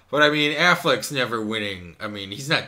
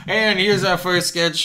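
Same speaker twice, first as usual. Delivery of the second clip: laughing, higher and louder mock announcer; giggling British-ish host intro; 6 semitones higher, 7 dB louder than usual. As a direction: announce it through a laugh, grandly. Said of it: "Welcome to Monty Python's".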